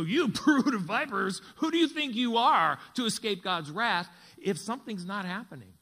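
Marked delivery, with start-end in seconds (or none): 0.0-4.1 much louder, pointed rebuke of "You brood of vipers"; a hammed-up accusing shout at the crowd; confrontational prophet. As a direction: thunder it like a prophet pointing a finger. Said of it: "point his finger in your face and go".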